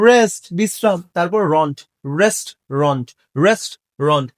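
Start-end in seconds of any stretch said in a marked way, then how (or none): 2.2-4.3 "রেস্টুরেন্ট" in two lower, separated parts, rest, ront; "রেস্টুরেন্ট" split into "rest" and "ront"; correct pronunciation. Shown as a demonstration. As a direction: model "রেস্টুরেন্ট" as rest-ront, dropping in pitch. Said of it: "এটার সঠিক উচ্চারণ".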